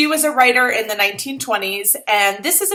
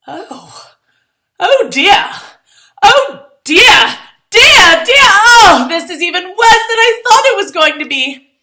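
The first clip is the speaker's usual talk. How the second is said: high, louder comic alarm; rising panic; 11 semitones higher, 10 dB louder than usual. Acted. Girl dragged around the floor, comic high panic. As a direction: squeal in mounting dismay. Dance going badly.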